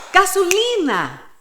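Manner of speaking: "gasolina" called out loud and high, falling at the end; last word of the drill, loud and high; lively game call. Shown as a demonstration. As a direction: finish the chain by calling "gasolina" out loud.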